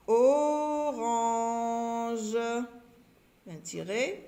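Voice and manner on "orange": orange stretched very long, o then range; drawn-out syllables. Shown as a demonstration. Teacher writing orange as she speaks, syllables drawn out. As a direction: hold each syllable of "orange".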